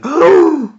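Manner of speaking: high, loud, scandalized "oh"; mock shock. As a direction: feign outrage with a sudden high cry. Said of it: "You'd never look at him as being like".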